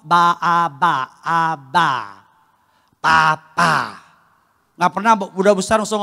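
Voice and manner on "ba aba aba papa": put-on child's first words. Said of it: "dia pasti ngomong".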